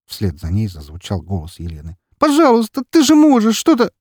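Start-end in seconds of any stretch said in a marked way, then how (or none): 2.2-3.9 очень высоко и громко; кричи деду в слезах; отчаянная мольба криком. Acted. Елена умоляет деда. (Елена кричит, умоляя деда)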